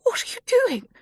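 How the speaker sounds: high, frightened question; sudden fear rising; alarmed cry. A narrator's reading. Mia alarmed as Sadie opens the doors. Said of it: "Mia said again".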